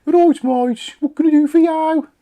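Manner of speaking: very high, loud mock Brummy accent. Host playing Siri gone Brummy, hammed-up Brummy accent. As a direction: exaggerate a Birmingham accent, pitched right up. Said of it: "that went into Brummy then".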